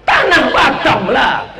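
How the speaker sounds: high, loud rising proclamation; full-force preaching. Acted. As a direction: call it out to the whole crowd. Preacher answers the crowd loudly.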